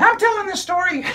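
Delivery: put-on high, bright child puppet storyteller; squeaky kid character excitedly telling a story; high-pitched puppet girl narrating. Puppet insists.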